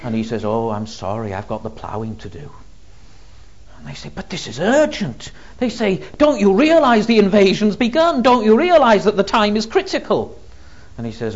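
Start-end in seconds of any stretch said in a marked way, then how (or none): 0.4-2.5 lightly voiced farmer, low and soft; understated, low-key refusal; reluctant farmer. Farmer declines the troops' plea. 4.1-5.3 higher-pitched, pleading insistence; acted urgency, rising in pitch; urgent protest. Troops press the reluctant farmer. 6.2-10.4 high, loud, insistent questioning; acted alarm, pitched right up; urgent exasperation. Troops urge farmer, invasion has begun.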